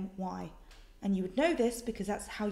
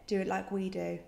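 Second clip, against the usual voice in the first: ordinary talk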